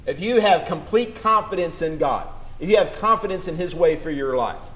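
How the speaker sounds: rising to a high, loud, slow promise; fervent pulpit climax, slowing down at the end; preaching at full force. Acted.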